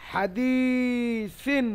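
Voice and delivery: "হাদিসিন" slowly, long i, ending on n; "হাদিসিন" recited with the vowel held; drawn-out recitation. Shown as a demonstration. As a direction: stretch the di of "হাদিসিন" and close on n. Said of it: "সিন হবে".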